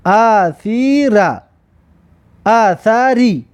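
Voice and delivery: very slow, drawn-out syllables; slow teacher's model reading. Shown as a demonstration. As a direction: stretch each syllable for learners to copy. Teacher models the example word.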